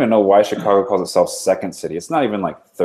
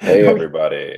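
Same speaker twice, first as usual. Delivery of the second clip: smooth radio DJ; 5 semitones lower than usual. Pretend. Mock radio DJ greeting.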